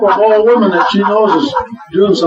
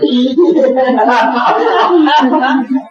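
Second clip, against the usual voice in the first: hearty laugh; 8 semitones higher than usual. Real, not acted.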